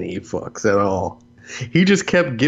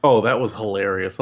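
ordinary talk, nothing marked

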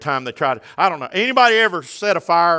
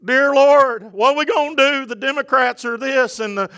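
higher, louder impassioned preaching; preaching at full force; 8 semitones higher, 4 dB louder than usual. Acted. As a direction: preach it hard to the congregation. Preaching climax rising.

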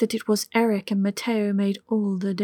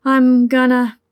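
urgent; 3 semitones higher, 7 dB louder than usual. A narrator's reading. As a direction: push it out on the edge. Lover nearing climax.

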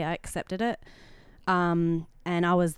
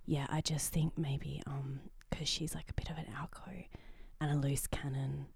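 soft gossip whisper; 8 dB quieter than usual. Real, not acted. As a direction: whisper so the walls can't hear. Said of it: "Why are you speaking so low today?".

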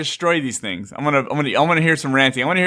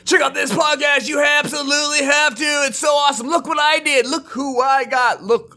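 much higher mock hype yell, slowing hard at the end; braggy hype; 12 semitones higher than usual. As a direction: hype your own podcast like an attention seeker. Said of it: "but I'm never like".

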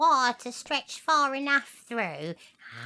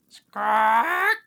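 louder squawk like a bird; loud parrot squawk; 10 dB louder than usual. Acted. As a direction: squawk back at her.

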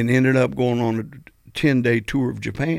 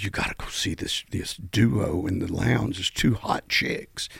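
hushed roadie; 4 semitones lower, 6 dB quieter than usual. Retold or imitated. Roadie whispering a tip about the lounge duo. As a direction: lean in and hush it like gossip.

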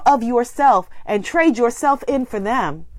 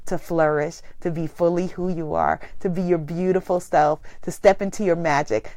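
ordinary talk

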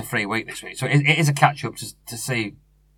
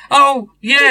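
mock impressed listener; 12 semitones higher, 8 dB louder than usual. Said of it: "They're thinking".

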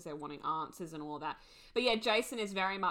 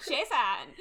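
put-on valley girl; 5 semitones higher, 6 dB louder than usual. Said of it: "making fun of the Valley Girl accent".

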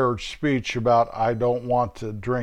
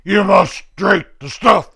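bullying interrogator, rising; 6 semitones higher, 8 dB louder than usual. Retold or imitated. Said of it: "the bad guys going".

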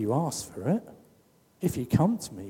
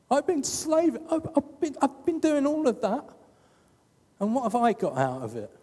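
much higher, whining self-pity; hammed-up martyr whine, far higher; whiny martyr; 11 semitones higher than usual. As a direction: play the hard-done-by martyr, nasal whine in pitch. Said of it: "The martyr".

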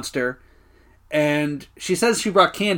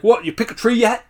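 higher, irritated mock question; pissy; 6 semitones higher than usual. Podcaster acting Darryl annoyed at the tree lot, pissy. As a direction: toss it out with mild testiness. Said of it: "he's all pissy".

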